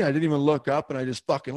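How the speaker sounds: ordinary delivery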